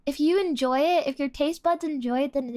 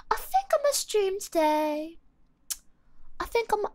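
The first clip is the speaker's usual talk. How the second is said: higher-pitched amateur British accent; 6 semitones higher, 3 dB quieter than usual. Shown as a demonstration. Streamer repeating her favorite phrase, higher British accent. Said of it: "with a British accent".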